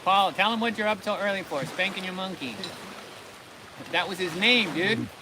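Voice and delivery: loud, high teasing call. Real, not acted. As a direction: rib him loudly across the table. Teasing friend shouts across table.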